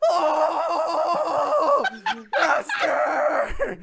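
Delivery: high anguished screaming wail; troll scream. Acted. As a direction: shriek it out in grief. Troll screams over burnt master.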